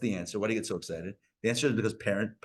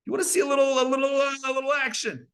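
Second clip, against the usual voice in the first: higher, playful, teasing little action; playful tease; 10 semitones higher, faster than usual. Pretend. God wanting a little action, playful teasing tone. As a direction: tease with a wink in the voice.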